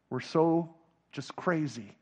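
usual speaking voice

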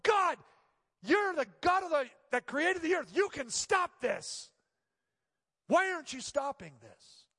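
loud, high pleading shout that slows at the end; angry cry to God. Retold or imitated. Alone in his apartment, pastor shouts at God in anger. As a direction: demand answers from heaven. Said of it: "I remember just in my apartment by myself going".

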